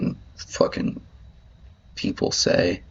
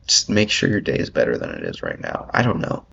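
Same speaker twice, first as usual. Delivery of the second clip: usual speaking voice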